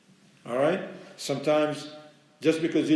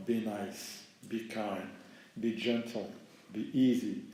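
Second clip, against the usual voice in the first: low, soft, soothing advice; gentle counsel; 5 semitones lower, 8 dB quieter than usual. Real, not acted. Preacher urging kindness to parents, gentle counsel. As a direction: say each one softly and gently.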